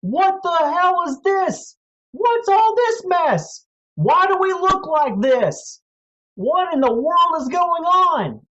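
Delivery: high, loud bewildered cries; panicked distant voices. Acted. Panicked villagers shouting in confusion. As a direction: villagers panicking at the strange change. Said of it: "voices coming from a far off distance".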